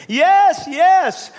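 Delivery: high, loud, frantic yes; desperate agreement. Acted. Hanging man tells the voice he trusts it, frantic yell. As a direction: cry out eagerly to be rescued. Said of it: "he cried out".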